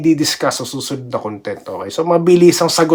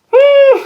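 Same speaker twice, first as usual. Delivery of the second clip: sharp high loud hum; a skeptical hum jumping way up; high loud doubtful hmm; 6 dB louder than usual. Real, not acted. Reacting to sweep prediction.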